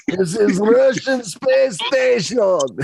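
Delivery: high, slow, hammed-up Russian accent; Peter Stormare. Co-host joining the Armageddon joke, high mock Russian accent. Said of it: "playing the Russian".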